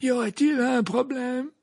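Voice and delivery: curious headmaster voice; intrigued. A narrator's reading. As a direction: ask with open puzzlement, pitched well up. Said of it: "l'air quelque peu intrigué".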